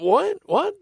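high startled double what; incredulous what. Retold or imitated. Husband stunned by his wife's takeout order, high incredulous what. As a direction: shoot the pitch up in disbelief.

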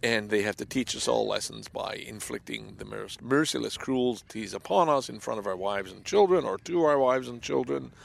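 ordinary talk

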